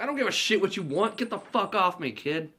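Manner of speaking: high, fast irritated rant; snapping at a kid. Retold or imitated. Santa yells at kid.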